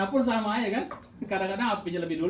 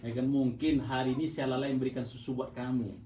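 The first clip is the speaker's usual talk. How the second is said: tender apology; 7 semitones lower, 4 dB quieter than usual. Acted. Voicing parent's bedtime apology.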